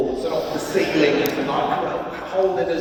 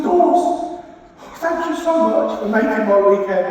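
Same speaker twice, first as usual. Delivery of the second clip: far higher, louder gushing, fading at end; emotional drunk fan; 13 semitones higher, 6 dB louder than usual. Retold or imitated. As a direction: gush with drunken emotion, then calm down.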